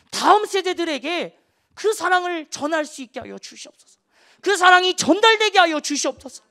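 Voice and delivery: high, loud, fervent sermon climax; impassioned preaching. Acted. Preacher urging parents to pray, in a loud fervent cry. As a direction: cry it out to the whole congregation.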